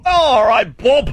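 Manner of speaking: put-on high, silly English-accented yell; mock English send-off. Host seeing the intern off in a high mock-English shout. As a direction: holler goodbye in a bad English accent.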